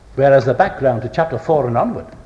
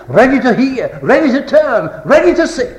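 each phrase pitched higher, building; rising triad; 8 semitones higher, 3 dB louder than usual. Real, not acted. Preacher urging readiness to see Christ, climbing higher each time. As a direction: climb step by step through the three phrases.

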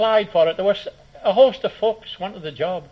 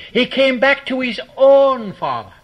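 higher pitch, "own" long and loud; strong stress on own; 4 semitones higher, 4 dB louder than usual. Shown as a demonstration. Preacher restoring the overlooked word, emphatic stress on "own". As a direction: ring out "own" as the key word. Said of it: "Notice the reflexive pronoun".

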